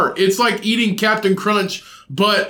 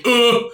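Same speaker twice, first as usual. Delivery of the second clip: imitated satisfied grunt, pitched up; mock pig-army call grunt; 5 semitones higher, 3 dB louder than usual. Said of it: "that's the call".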